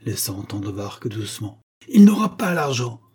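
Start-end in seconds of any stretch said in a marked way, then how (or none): 1.9-3.0 loud, high, forceful refusal; emphatic raised voice; energetic. A narrator's reading. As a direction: declare it with energy. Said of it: "dit Jacob avec énergie".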